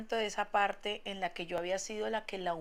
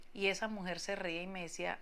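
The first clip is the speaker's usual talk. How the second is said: ordinary talk, nothing marked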